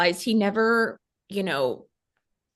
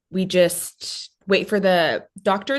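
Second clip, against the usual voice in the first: usual speaking voice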